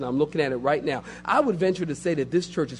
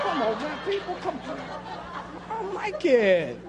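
laughing protest; 9 semitones higher, 6 dB quieter than usual. Acted. Laughing, high mock protest.

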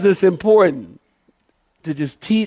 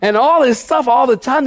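high-pitched, rapid-flowing sermon rant; impassioned preacher in full flow; fired-up preaching; 8 semitones higher than usual. Real, not acted.

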